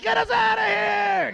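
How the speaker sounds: high, drawn-out comic scream; scared cry. Acted. Terrified body part begging to flee, high slow scream. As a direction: stretch it out as a high mock-panicked plea. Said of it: "That's when you know you're scared".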